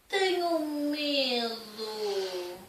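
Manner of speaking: very slow, small frightened voice; scared little bear. Acted. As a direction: whimper it out slowly. (voz assustada e lenta)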